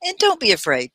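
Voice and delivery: usual speaking voice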